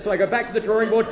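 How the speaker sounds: normal talking voice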